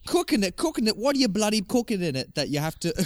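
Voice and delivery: high, incredulous British voice; hammed-up British indignation, pitched high; British accent. Husband questioning honey's cooking, high British accent. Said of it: "I'm still going into the British accent".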